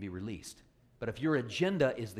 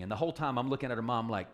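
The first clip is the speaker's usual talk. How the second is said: usual speaking voice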